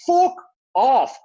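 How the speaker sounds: loud, emphatic dismissal with a break between words; exasperated outburst. Real, not acted. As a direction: snap back loudly at the teachers' union. Ranting back at teachers' union.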